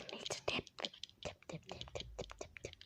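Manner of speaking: soft whispered chant to herself; whispering. Real, not acted. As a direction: whisper it while dipping. Dipping the bubble stick quietly.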